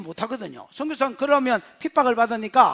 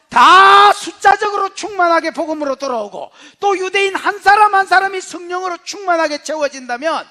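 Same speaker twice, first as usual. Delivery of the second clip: loud, raised, impassioned preaching; full-force preaching; 5 semitones higher, 10 dB louder than usual. Real, not acted. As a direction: build to a forceful, high-pitched proclamation. (목소리 높여 힘차게 설교)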